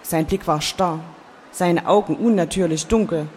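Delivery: plain reading voice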